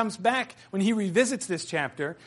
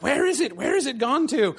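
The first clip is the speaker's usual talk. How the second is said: higher, anxious creature voice; Gollum; 7 semitones higher than usual. Retold or imitated. Teacher voicing Gollum searching for vanished Bilbo, high and anxious. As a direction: fret like a creature who lost something. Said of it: "And Gollum is like".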